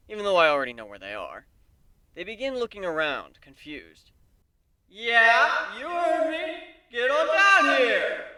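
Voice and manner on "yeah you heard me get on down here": higher, louder, slow teasing call; showman shout. A narrator's reading. Griff taunting Celestia to come down, loud showman call. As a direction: bellow it down to them with a grin. Calling princesses to stage.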